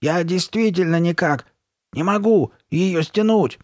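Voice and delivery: high, frustrated wail; hoarse distress. A narrator's reading. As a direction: plead that you're truly stuck, stretching words. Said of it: "сиплым голосом возразила Карли Бет".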